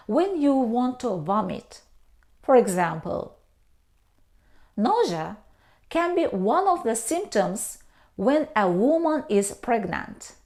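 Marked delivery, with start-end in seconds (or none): none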